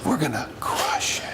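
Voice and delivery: whispered, deflated excitement; words excited, voice lifeless; flat, unexcited delivery. Shown as a demonstration. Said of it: "So my words are saying what?".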